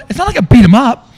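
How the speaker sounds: loud, high defensive protest; indignant. Retold or imitated. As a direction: argue back at an accusing wife. Defends himself in retold quarrel.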